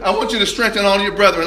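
ordinary talk

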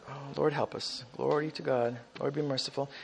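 low, soft pleading to the Lord; murmured prayer. Real, not acted. Preacher murmuring a prayer to the Lord, low and soft. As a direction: pray it under your breath. Quiet prayer aside in sermon.

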